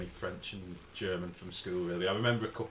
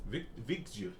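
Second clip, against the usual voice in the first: ordinary talk, nothing marked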